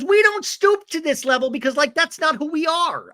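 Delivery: high, loud, hammed-up declaration; mock outraged protest. Recapper acts housemate refusing to stoop, mock outrage. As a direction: proclaim it in a shrieky put-on voice.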